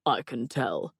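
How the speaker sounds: mock-wounded sigh; put-on, resigned lament. A narrator's reading. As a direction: let it sink like a wronged lover. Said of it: "he sighed in my ear".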